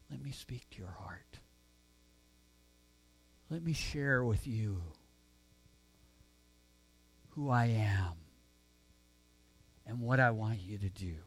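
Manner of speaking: God's voice, hushed and low, barely above a breath; gentle whisper. Acted. God speaking softly to Elijah, a gentle whisper. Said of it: "He spoke to Elijah in a gentle whisper".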